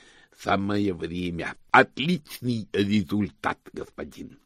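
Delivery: slow, deliberate lisping henchman; servant Igor. Acted. Igor satisfied with Hubert's answer, slow servant lisp. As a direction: pronounce it ponderously, trailing off on the title.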